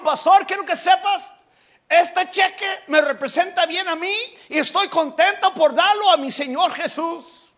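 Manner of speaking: high, loud and eager, slowing at the end; proud giver. Retold or imitated. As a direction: declare the big gift with pride. Generous man speaks proudly.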